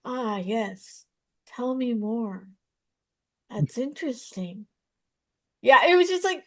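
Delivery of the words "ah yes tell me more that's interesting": softer, lightly voiced mock therapist, slow and soothing; a hint of a calm, nodding therapist; therapist impression. Comedian teasing how therapists talk, in a softer mock-soothing voice. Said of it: "And the third one is therapists".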